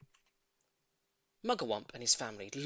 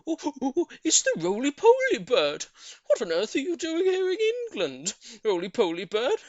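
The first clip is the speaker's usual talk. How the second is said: excited monkeys; 10 semitones higher, 9 dB louder than usual. A narrator's reading. Monkeys greet bird.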